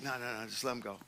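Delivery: calm them down under your breath; hushed restraint. Retold or imitated. Pastor holding them back.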